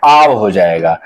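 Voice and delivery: teacher stressing the long आव sound; stressed आव. Shown as a demonstration. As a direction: lean on आव, long vowel, deep drop. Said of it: "इस ओ में एक मात्रा बढ़ाया".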